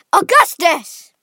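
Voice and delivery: loud, high, drawn-out call; father shouting his son's name; father crying out. A narrator's reading. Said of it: "cried Mister Gloop".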